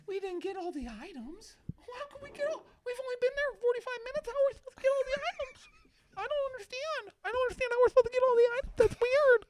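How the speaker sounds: very high, squeaky mock voice; squeaky whining impression of the wife. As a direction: whine it in a silly falsetto. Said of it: "mocking wife's complaint".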